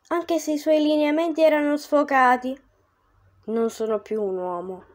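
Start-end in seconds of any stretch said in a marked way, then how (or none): 3.4-4.8 solemn ghost. Acted. Ghost Virgil answers.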